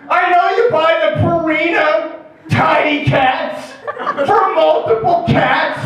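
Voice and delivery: high-pitched, outraged accusing yell; angry and accusatory. Acted. Addict son accuses mom of holding out, angry. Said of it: "you get angry and accusatory".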